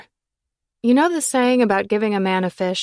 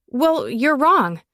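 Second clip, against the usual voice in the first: slow, higher, louder dismissal; slow scornful raised voice; bitter retort; 7 semitones higher, 6 dB louder, slower than usual. A narrator's reading. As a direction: lean hard on every word. Bitter retort after a laugh.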